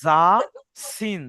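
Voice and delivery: যা and সিন, tongue tip pressed down behind the teeth; letter demo. Shown as a demonstration. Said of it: "জিভটা নিচের দাঁতে চেপে ধরবেন".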